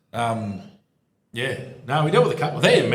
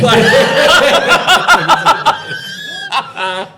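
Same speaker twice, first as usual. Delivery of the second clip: big laugh; 14 dB louder than usual. Real, not acted. Laughing at a colleague's joke.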